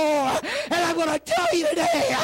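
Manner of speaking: high, strained, full-force sermon cry; impassioned preacher at the peak; shouted preaching climax. Real, not acted. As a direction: cry it out to the congregation at fever pitch.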